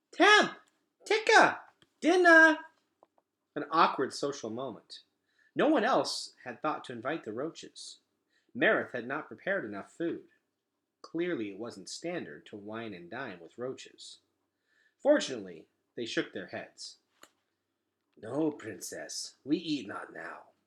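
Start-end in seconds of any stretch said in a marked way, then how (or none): 0.1-2.6 put-on very high, loud little-girl shout; a small child bossily summoning her friends; impatient toddler call. Boots summoning the roaches to dinner, impatient high toddler shout. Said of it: "waved impatiently to the roaches". 18.2-20.5 slow, low, lightly voiced bug; a hint of a solemn insect, drawn out; polite roach voice. Roaches politely turning down the princess, lightly voiced slow bug. Roaches decline dinner invitation.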